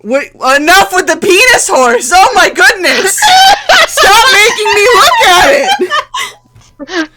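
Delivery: loud, high protests through laughter; losing it laughing, begging them to stop posting the picture; real laughing shouts. Real, not acted. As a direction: yell at the players while cracking up. GM cracks up at a picture.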